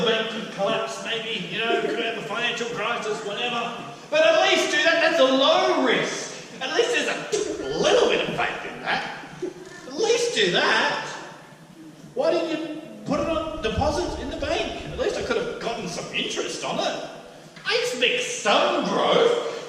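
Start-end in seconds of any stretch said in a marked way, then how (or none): 4.1-14.3 emphatic insistence. Acted. Master pleading for minimal risk. 17.7-19.7 slow, higher demand through a laugh. Acted. Master expecting growth, laughing.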